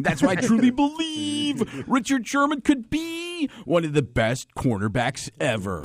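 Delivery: an overexcited football analyst; sportscaster insisting on his tape study; Ron Jaworski. Retold or imitated. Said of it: "the Ron Jaworski impression".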